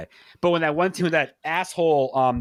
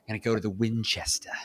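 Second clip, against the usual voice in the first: whisper; 7 semitones lower, 7 dB quieter than usual. Retold or imitated. Conspiratorial aside about hideout.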